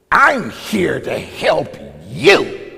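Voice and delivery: loud, slow, drawn-out snap; retold snapping shout. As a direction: snap it back slowly and loudly. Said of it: "the person snaps back at me".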